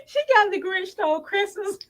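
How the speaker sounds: squeaky laughing through the line; giggling while speaking. Real, not acted. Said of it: "I can't stop laughing at it".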